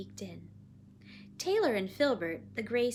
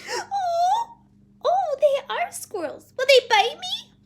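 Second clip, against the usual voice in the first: very high, loud, nervous surprise; startled little girl; 14 dB louder, slower than usual. Acted. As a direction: squeal it like a nervous child. Child surprised, still nervous.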